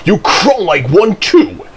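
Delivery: high, firm retort. A narrator's reading. Thomas taunts Percy, firm high-pitched jibe. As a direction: land the insult, pitch lifted. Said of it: "continued Thomas firmly".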